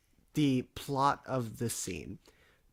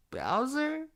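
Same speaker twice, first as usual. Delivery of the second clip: surprised. Retold or imitated. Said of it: "And I'm like".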